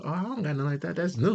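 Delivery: high-pitched imitation of an interested friend; intrigued friend. Friend spotting a new toy in the store, intrigued.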